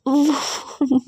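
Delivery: light falling giggle; high giggle. Acted. Partner caught laughing while complaining of pain, high giggle. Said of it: "तो आप हंस क्यों रहे हो".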